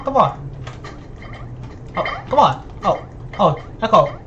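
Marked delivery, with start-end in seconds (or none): none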